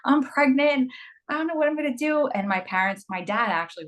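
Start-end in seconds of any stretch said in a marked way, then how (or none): 0.0-2.3 nervous confession. Retold or imitated. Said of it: "and I was like".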